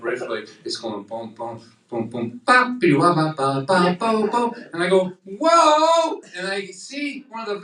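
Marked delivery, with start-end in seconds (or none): 1.3-4.5 bebop heartbeat scat. Retold or imitated. Heart doing bebop riff. 5.2-6.2 high, loud surprised whoa; loud high startled cry at his own heart. Retold or imitated.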